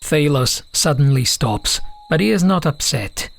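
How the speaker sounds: ordinary delivery, nothing marked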